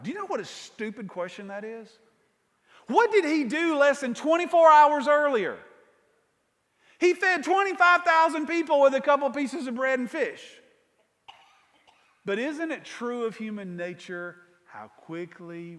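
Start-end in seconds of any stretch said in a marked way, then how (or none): 2.9-10.4 louder, higher, driving the point home; rising to full force on the miracle; emphatic preaching. Real, not acted. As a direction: preach it loud and high. Preacher exclaims rhetorical question.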